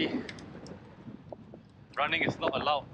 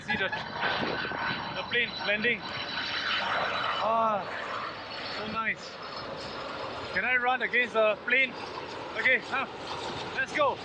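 excited; 8 semitones higher than usual. Real, not acted. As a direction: rev up like racing a plane. Racing a landing plane.

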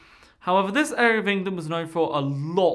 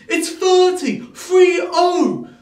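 high loud shouted correction; hammed-up shrieking mum; mock shout; 10 semitones higher, 9 dB louder, much slower than usual. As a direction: bellow the number at the staff. Mother corrects the count.

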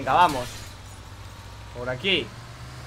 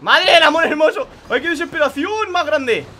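desperate outcry; 5 semitones higher, 5 dB louder than usual. Real, not acted. Gamer despairing at the hardest part of the race. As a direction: plead with the game in despair. Struggling on the hard section.

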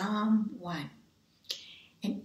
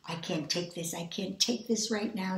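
ordinary talk

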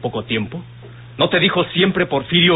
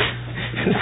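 soft teasing laugh; 6 dB quieter than usual. Acted. Mocking her jealousy.

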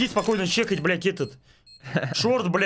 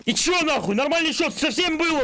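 high, furious yelling; outraged yell; 13 semitones higher than usual. Real, not acted. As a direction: shout in disbelief at the score.

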